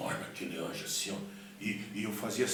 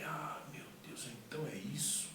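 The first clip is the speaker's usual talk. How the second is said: hushed realisation; 5 semitones higher, 8 dB quieter than usual. Retold or imitated. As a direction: let it sink in under your breath. Sudden realisation, retold to himself.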